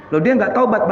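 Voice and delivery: ordinary talk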